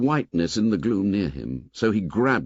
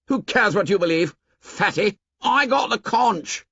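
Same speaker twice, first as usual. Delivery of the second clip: angry; 11 semitones higher than usual. A narrator's reading. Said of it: "unaccountably angry".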